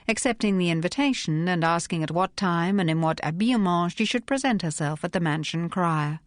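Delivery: ordinary delivery, nothing marked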